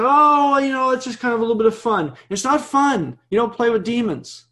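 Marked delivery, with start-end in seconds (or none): 0.0-2.1 mocking dismissive people. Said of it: "people say".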